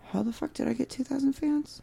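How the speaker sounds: high, soft stunned wonder; amazed disbelief. Retold or imitated. Musician waking up to fans, amazed. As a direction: marvel at it under your breath.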